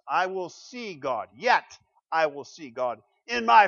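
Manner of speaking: slow, weighty "I will see God. Yet I will see God in my flesh" with "my" leaned on hard; stress on my. Shown as a demonstration. Preacher to congregation, stressing "my" slowly. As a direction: lean into "my" in "in my flesh", deliberately slow. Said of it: "repeats quote slowly with stress on my".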